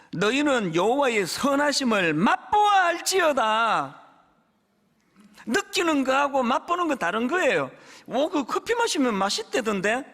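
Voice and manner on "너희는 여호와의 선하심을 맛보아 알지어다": slow, solemn scripture reading. Shown as a demonstration. Pastor reads the psalm verse slowly to the congregation. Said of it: "시편 삼십사 편 팔 절이요".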